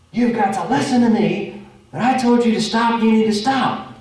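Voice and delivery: loud, high, urgent parental scolding. Retold or imitated. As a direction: scold the child who nearly got hit. Parent scolding child after street.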